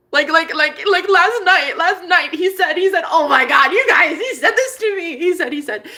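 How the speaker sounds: high, excited stammering; loud, laughing squeal of excitement; giddy gushing. Real, not acted. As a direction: trip over the words with delight. Gushing about last night to viewers.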